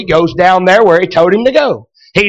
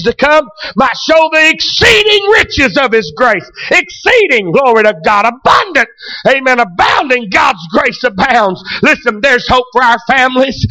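full-force preaching; 4 semitones higher than usual. Acted. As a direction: preach it out, building to a shout. Sustained shouted preaching.

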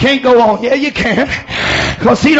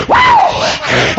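high preacher's "woo"; revival whoop. Real, not acted. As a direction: let out an exultant whoop. Preacher whoops mid-sermon.

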